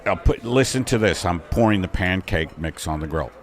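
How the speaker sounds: low cook voice. Acted. Cook character in improv.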